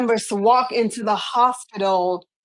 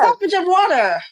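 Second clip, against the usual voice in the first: light Antiguan lilt, pitched up; Antiguan accent; 8 semitones higher than usual. Shown as a demonstration. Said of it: "with your Antiguan accent".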